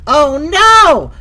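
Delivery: high, very loud cry of dismay; big, high yell of dismay. Real, not acted. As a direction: shout it out high in alarm. Cries out at game trouble.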